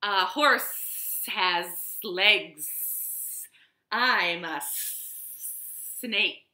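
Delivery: slow, drawn-out snake voice with hissed s; hissing snake. Acted. Snake answering Cowboy, slow and hissing. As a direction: stretch the words like a lazy snake. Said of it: "said the snake".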